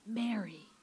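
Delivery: soft, quiet, lingering on the name; tender calling by name. Retold or imitated. Jesus calls Mary by name, soft and tender. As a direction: say her name gently, almost a whisper.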